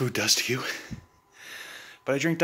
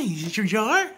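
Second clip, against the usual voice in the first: high, sing-song pet voice; 9 semitones higher than usual. Real, not acted. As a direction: coo it up high like to a puppy. Cooing at the dog.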